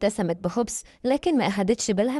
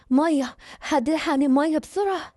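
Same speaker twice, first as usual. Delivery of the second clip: higher-pitched cry for help; urgent plea; 6 semitones higher than usual. Acted. Father's mouth burns from chilli at dinner, urgent plea. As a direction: call out urgently for relief. Burning mouth, begging for water.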